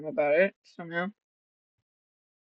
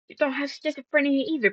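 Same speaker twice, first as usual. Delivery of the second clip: calling her out; 8 semitones higher, faster than usual. Retold or imitated. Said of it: "I'm like".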